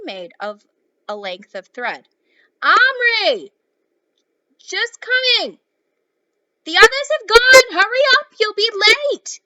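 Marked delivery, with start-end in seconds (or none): 2.6-3.5 high, loud call of a name; mother calling. Acted. Mother calling her son to hurry, loud high shout. 4.6-5.6 high, loud, slow call back; shouted reply. Acted. Boy answering his mother from upstairs, loud high shout. 6.6-9.4 high, loud call of a name; mother calling. Acted. Mother calling her son to hurry, loud high shout.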